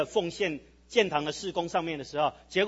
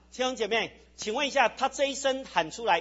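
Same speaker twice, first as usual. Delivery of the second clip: usual speaking voice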